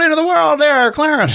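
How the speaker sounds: high, strained mock shout; mock stern call. Movie character confronting Clarence, high mock-stern shout. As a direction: call him out in a big high voice.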